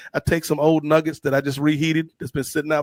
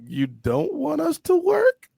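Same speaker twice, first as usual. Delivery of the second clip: disbelief; 9 semitones higher, slower than usual. Retold or imitated. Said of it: "I'm like".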